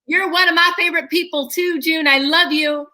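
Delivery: high-pitched gushing character voice; lavish affection in a put-on housewife voice; Kathy impression. Guest tells June she's a favorite, playful character gushing. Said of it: "just like Kathy would have been".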